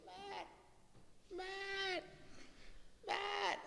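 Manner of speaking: high, thin call of a name from far off; imitating a faraway call, high and faint; distant call imitated. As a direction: call his name like Mum shouting from the paddock. Mum calling him from outside.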